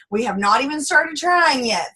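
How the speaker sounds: normal talking voice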